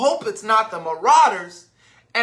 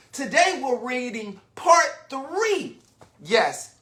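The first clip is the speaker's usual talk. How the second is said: ordinary delivery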